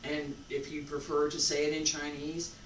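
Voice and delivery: ordinary talk, nothing marked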